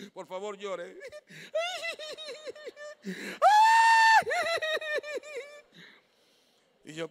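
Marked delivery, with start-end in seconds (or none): 1.0-5.9 loud high laughing shout; howling laugh, far higher and louder; long shouted laugh. Real, not acted. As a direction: let out a long shrieking laugh. Laughs at the scene.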